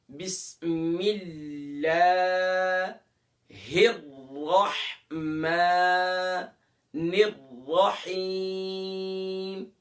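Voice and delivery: slow melodic recitation; slow Quranic chanting. Shown as a demonstration. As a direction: chant it long and drawn out.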